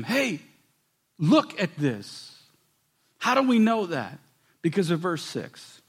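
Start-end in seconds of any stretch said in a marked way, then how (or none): 0.0-2.3 pleading. Retold or imitated. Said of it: "He's pleading with them".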